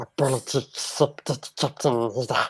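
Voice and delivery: soft, breathy Vader take; a hint of Vader forgiving; Vader quote. Co-host does the line on request. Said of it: "You do that one".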